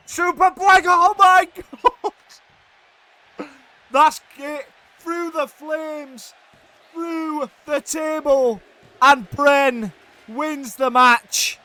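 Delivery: screaming high and loud, stretching the words out slowly; ecstatic commentator scream. Real, not acted. Commentator screaming as the champion wins through the flames. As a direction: lose it completely as the winning move lands. Climactic finish of the match.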